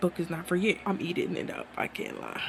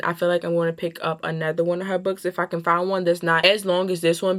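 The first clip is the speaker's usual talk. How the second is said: ordinary delivery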